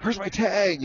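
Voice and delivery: high-pitched excited question. Retold or imitated. New owner rushing in after the horse wins, excited. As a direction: ask it high and eager, re-enacting the moment. Winner's circle after the win.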